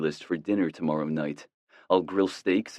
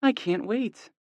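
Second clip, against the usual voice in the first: high, happy mom voice; a mom beaming at the news; smiling mother; slower than usual. A narrator's reading. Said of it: "hear the smile in her voice".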